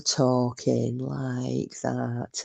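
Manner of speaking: very slow, quiet drugged drawl; on downers. Retold or imitated. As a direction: drag each word out like someone sedated. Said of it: "she sounds like she's, she's on flipping downers".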